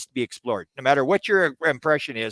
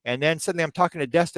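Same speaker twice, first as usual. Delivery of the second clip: usual speaking voice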